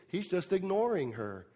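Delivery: ordinary talk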